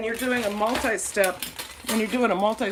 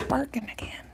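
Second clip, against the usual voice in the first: whisper; 5 semitones higher than usual. Real, not acted. Aside about the barking dog.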